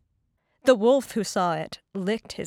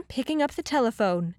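ordinary delivery

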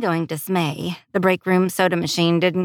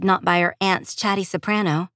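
ordinary delivery

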